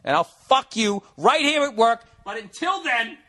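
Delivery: high, hammed-up lustful outburst. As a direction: push it up high, mock climax. Acted climax of fantasy speech.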